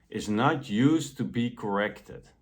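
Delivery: usual speaking voice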